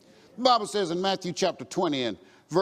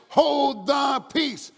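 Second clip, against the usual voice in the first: loud, high, drawn-out command; stern command; 7 semitones higher, 5 dB louder, much slower than usual. Acted. The multitude rebukes the blind man, commanding loudly. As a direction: silence him with a slow, booming order.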